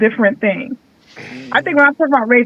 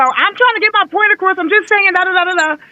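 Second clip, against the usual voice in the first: mock emotional rant, pitched up; imitating someone talking a little loud; 7 semitones higher than usual. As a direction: act out someone getting worked up but not aggressive. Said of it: "if you, like, talking a little loud".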